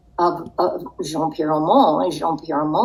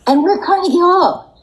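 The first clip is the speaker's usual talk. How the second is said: excited; 13 semitones higher, 6 dB louder, faster than usual. Real, not acted. Marvels at the glamorous setting.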